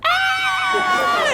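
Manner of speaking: very high, long scream; mock terrified scream. Teens see the knife man coming, mock terrified scream. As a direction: squeal in mock terror.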